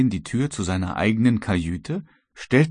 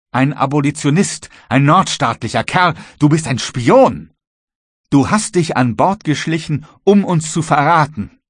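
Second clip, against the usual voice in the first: loud, high-pitched outrage; angry accusation; 7 semitones higher, 7 dB louder than usual. Acted. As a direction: denounce a traitor in a raised voice. Maat beschuldigt Matrosen als Spion. (wütende, laute Anklage)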